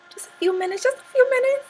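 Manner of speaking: very high, squeaky little voice; playful plea. Real, not acted. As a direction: pipe it up high, coaxing the viewers to wait. Playfully asking viewers to wait.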